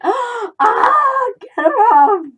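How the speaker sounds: high, loud acted shriek for help; panicked scream. Owner bitten by the cat, mock panicked scream. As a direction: shriek as if a cat has latched on.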